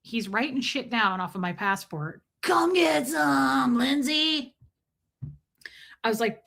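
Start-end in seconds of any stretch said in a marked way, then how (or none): 2.4-4.5 higher, slow, lightly put-on official; drawn-out taunt from the border officer; mock officer voice. Border officer calls her over, slow mock voice.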